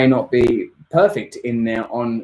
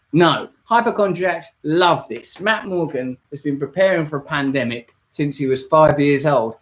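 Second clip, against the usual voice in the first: usual speaking voice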